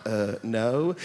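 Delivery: very low, hesitant reply; deflated little admission; sheepish answer. Retold or imitated. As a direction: drop the pitch right down and admit it. Sheepish answer to serious questions.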